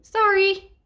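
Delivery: high, loud hasty apology; yelped sorry. Acted. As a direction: squeak it out while falling. Baddies bump into the king.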